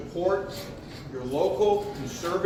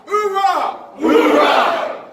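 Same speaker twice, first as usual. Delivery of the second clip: shouted military oorah, higher; loud gung-ho soldier; 7 semitones higher, 6 dB louder, much slower than usual. Acted. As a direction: bark out the oorah like a marine. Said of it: "Can I get an "oorah"?".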